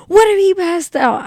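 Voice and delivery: worried mom. Retold or imitated. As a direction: mimic a mother fretting. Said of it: "my mom was so worried".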